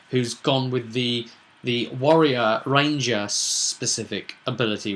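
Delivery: normal talking voice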